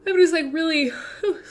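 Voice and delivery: talking through a yawn; words stretched by a yawn. Real, not acted. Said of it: "sometimes I just yawn".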